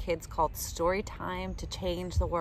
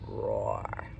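put-on low, soft lion roar for kids; 5 dB quieter than usual. Showing kids how lions talk, a low soft roar. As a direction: growl it like a gentle lion. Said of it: "That's how lions talk".

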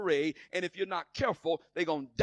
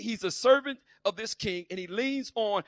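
usual speaking voice

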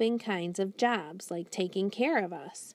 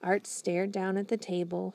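usual speaking voice

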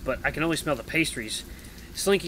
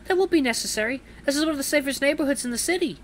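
put-on high, prim old lady retort; indignant granny voice, pitched up; affronted granny; 13 semitones higher, 3 dB louder than usual. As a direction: stand your ground like a granny. Granny refuses the racketeer.

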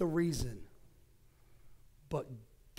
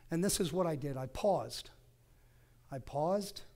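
ordinary delivery

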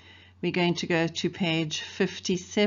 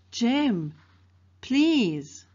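pleading little sister; 5 semitones higher, 4 dB louder than usual. Acted. Scout pleading with brother.

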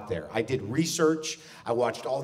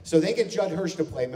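normal talking voice

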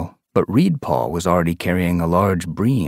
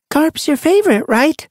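put-on high, louder young she-cat; asking; 4 dB louder than usual. A narrator's reading. Sun Paw teases her friend, high and knowing. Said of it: "Sun Paw asked".

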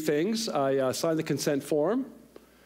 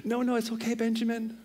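put-on higher girlish voice, gently reassuring; sisters consoling brother; 7 semitones higher than usual. Big sisters to little brother about the dead cat, consoling. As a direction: imitate young sisters soothing their brother. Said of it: "the girls immediately go".

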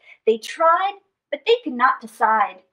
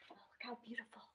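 awed hushed whisper; whispered storytime awe; 3 semitones lower, 33 dB quieter, much faster than usual. Acted. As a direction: breathe it in wonder. Awed aside to kids.

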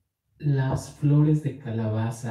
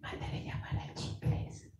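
soft unvoiced whisper; 13 dB quieter than usual. Shown as a demonstration. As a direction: whisper it like a secret. Continues whispered message reading.

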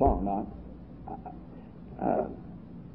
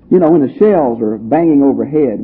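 ordinary delivery

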